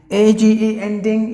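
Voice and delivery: letters spelled out loud and high. Shown as a demonstration. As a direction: spell out A G E clearly. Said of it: "you have to pronounce it not with a long sound".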